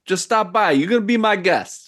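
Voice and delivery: high, brash character voice; gangster greeting. Acted. Host introducing himself to the newcomer, high and brash. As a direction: come on friendly and pushy like a mobster. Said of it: "he's like".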